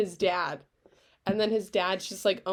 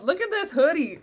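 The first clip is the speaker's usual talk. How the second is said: higher, louder, proud; excited show-off; 8 semitones higher, 6 dB louder than usual. Real, not acted. Friend showing her Naruto hoodie to viewers, high and loud. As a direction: show it off loudly.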